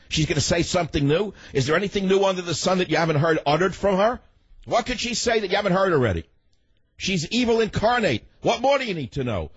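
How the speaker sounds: high-pitched, indignant tirade; exasperated rant. Real, not acted. Radio host railing about a politician to listeners, exasperated high-pitched rant.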